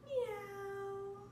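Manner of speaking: high, soft meow; cat meow. Pretend. Mother meowing like a kitten for her toddler, high and soft. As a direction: mew it like a kitten. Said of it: "What does the cat say?".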